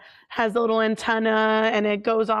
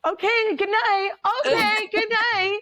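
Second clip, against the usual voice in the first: high-pitched, hurried-off goodnight; high comic brush-off; mock-panicked goodnight; 10 semitones higher than usual. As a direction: act out nervously dismissing a friend. Retells nervous goodnight after creepy remark.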